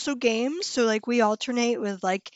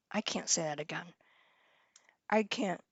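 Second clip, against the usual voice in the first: mumbled under her breath, much softer; quiet mutter; 6 semitones lower, 11 dB quieter than usual. Real, not acted. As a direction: say it low to yourself, barely voiced. First take, half-voiced mutter.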